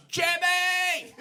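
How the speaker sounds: high, drawn-out yell of a name. Acted. Introducing the Cockney boy to the crowd, drawn-out high yell.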